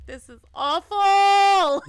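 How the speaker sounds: slow, loud, high sobbing line; crying wail. Real, not acted. Player reading the sad line through loud sobs. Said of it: "thought I was ready for this".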